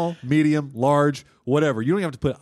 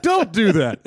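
higher again, laughing, falling end; pleading warning; 6 semitones higher, 5 dB louder, slower than usual. Acted. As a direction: plead once more, laughing. Repeated warning.

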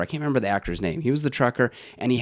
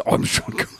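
put-on breathy, half-whispered stab at a Scottish Sean Connery accent; failed Sean Connery impression; faster than usual. Host trying Sean Connery's Scottish accent for his co-host, barely voiced and failing. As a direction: try the Scottish Connery accent and give up halfway. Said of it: "I can't do it".

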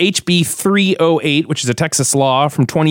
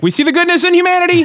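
high, fast mock-gushing voice; sing-song mock praise, pitched way up; gushing passerby; faster than usual. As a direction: play a starry-eyed fan of humanity. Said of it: "being like".

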